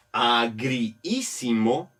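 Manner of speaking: "Agriísimo" slowly, in parts a-gri-ísimo; drawn-out syllables. Shown as a demonstration. Teacher dividing the word for students, drawn-out syllables. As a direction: stretch "Agriísimo", keeping both i's audible. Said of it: "es mejor alargar un poco la palabra".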